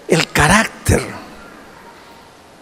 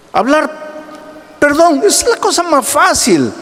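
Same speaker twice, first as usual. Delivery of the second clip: higher, rising sermon voice building to a peak; preaching at full force; 11 semitones higher than usual. Acted. Pastor preaching hard to his church about temper. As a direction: preach it out to the congregation.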